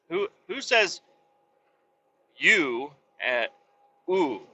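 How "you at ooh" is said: ordinary talk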